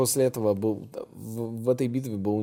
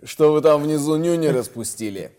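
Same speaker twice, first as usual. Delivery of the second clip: higher, louder mocking yell; mock shout; 7 semitones higher, 12 dB louder than usual. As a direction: bellow down at them scornfully. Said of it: "И те такие".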